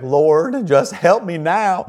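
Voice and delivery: high, loud, drawn-out Tennessee plea; Tennessee accent. Retold or imitated. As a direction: imitate a deep Tennessee prayer, stretched out and loud. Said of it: "in deep Tennessee, he had this accent".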